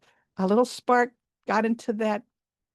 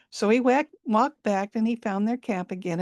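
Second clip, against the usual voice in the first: ordinary delivery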